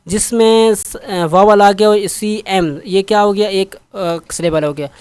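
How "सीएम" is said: normal talking voice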